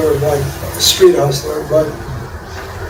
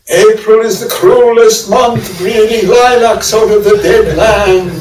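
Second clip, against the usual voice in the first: slow, higher, louder put-on British recital; mock British poet reading; 5 semitones higher, 4 dB louder, slower than usual. Visiting poet reads Eliot to a graduate crowd, affected British accent. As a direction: intone it slowly like a poet posing as an Englishman. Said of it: "he read something like this".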